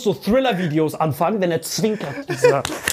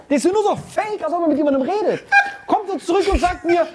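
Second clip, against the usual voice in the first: usual speaking voice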